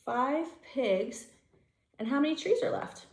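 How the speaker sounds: usual speaking voice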